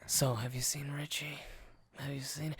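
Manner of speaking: soft, whispery, dying mobster voice; faint whisper, barely holding on; deathbed whisper. Retold or imitated. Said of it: "doing a Marlon Brando minutes before he died".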